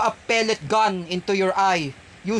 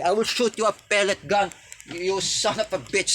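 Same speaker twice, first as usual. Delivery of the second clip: usual speaking voice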